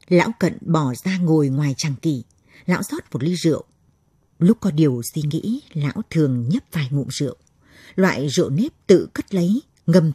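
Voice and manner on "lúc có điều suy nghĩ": ordinary delivery, nothing marked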